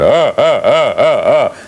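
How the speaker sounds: choppy mechanical laugh in pulses; staccato robot laugh. Retold or imitated. Showing his own robot laugh from another show: staccato mechanical laugh. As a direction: chop the laugh into beats. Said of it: "I went ... my laugh".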